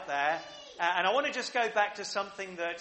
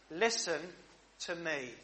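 softly voiced divine call, softer at the end; quiet voice of God; 3 semitones lower, 7 dB quieter than usual. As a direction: let God's plea fall away into a hush. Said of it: "God keeps on basically saying to us".

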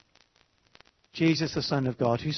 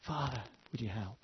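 softer, aching request for help; hushed plea; 4 semitones lower, 12 dB quieter than usual. Retold or imitated. Jesus in the garden asking the Father for help, hushed. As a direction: ask it almost under your breath. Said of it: "The rage of the battle".

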